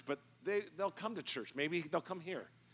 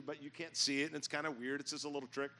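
normal talking voice

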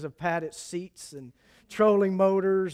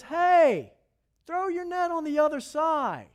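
calling out; 8 semitones higher, 4 dB louder than usual. Acted. Jesus calls from the shore.

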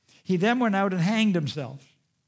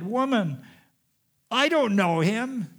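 raised pitch, slow emphatic protest; panicked denial; 6 semitones higher, 3 dB louder than usual. Acted. Peter denies knowing him to the servant girl, panicked. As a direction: deny it as if losing your nerve. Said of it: "Peter just loses it".

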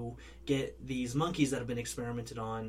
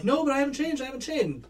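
pleading; 12 semitones higher, 4 dB louder, faster than usual. Retold or imitated. Pleading infected man quoted.

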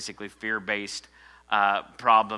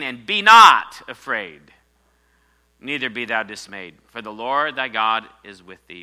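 loud, higher preaching of the command; proclaiming scripture; 4 semitones higher, 10 dB louder than usual. Acted. Pastor shouting God's command to be strong, loud proclamation. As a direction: call it out like a command from heaven.

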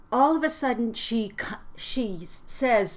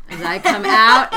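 louder, with a laugh in it; loud demo, laughing; 13 dB louder than usual. Shown as a demonstration. Mother modeling the line for her daughter, loud and laughing. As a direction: call it out then hand it over. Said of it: "scream it, whatever".

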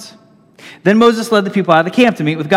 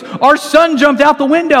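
higher, urgent panicked dad; frantic dad; 9 semitones higher, 4 dB louder than usual. Retold or imitated. Father racing out the door telling his wife, urgent and high. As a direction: blurt it out in a panic. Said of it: "He goes".

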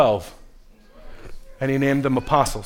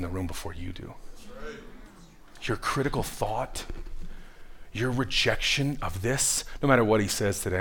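low, very soft preacher's voice; hushed preaching; 9 semitones lower, 15 dB quieter than usual. Acted. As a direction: bring it right down, almost to a whisper.

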